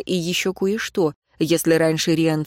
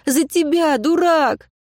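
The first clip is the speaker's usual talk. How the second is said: shouting; 10 semitones higher, slower than usual. Acted. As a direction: yell it at him, fond and cross. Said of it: "почти закричала".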